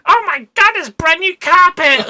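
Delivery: horrified shriek. Retold or imitated. Daughter horrified at ruined carpet.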